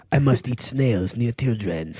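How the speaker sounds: hammed-up French accent, unhurried; mock French accent. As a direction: play a Frenchman excusing himself. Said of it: "Everything about France is just smoking".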